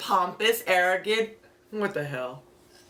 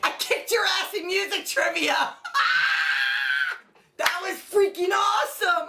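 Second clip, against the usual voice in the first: high laughing victory yell; gloating shout; 10 semitones higher than usual. Real, not acted. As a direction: crow over the win. Gloating after winning.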